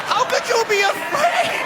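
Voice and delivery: very high, loud, slow outraged yell; slow high indignant shout; incredulous shout. Real, not acted. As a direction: bellow it in disbelief. Comic ranting at fearful woman.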